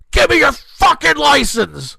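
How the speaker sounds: put-on loud, high, slow enraged shout at the kids; furious yell. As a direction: bellow it out like you're losing it. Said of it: "I'm so fucking angry now".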